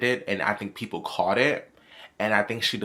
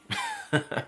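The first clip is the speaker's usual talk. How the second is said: high little laugh; brief high chuckle after a wry remark; short chuckle; 4 dB quieter than usual. Real, not acted. Chuckles after saying "allegedly.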